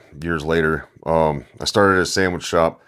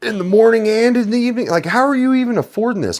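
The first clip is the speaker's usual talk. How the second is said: much higher, mock-shocked voice, louder on the second line; incredulous rant; 4 dB louder than usual. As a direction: squeal it out in disbelief. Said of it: "And I'm like".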